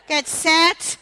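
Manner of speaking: loud rising race start call; shouted start. Real, not acted.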